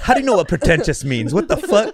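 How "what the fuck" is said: surprised. Real, not acted. Mock outrage at big word.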